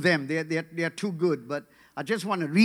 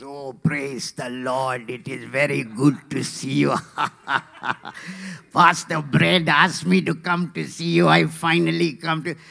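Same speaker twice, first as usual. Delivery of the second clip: imitated jolly Sri Lankan accent; hammed-up Sri Lankan pastor, laughing heartily mid-speech; Pastor Joseph Stephen; 3 semitones lower than usual. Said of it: "that's how he talks".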